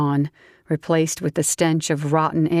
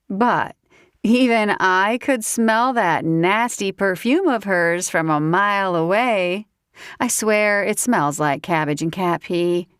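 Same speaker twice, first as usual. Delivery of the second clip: put-on higher, giggly drunk southern woman; tipsy southern woman; 4 semitones higher than usual. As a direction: play the tipsy belle teasing him. Grace jealous.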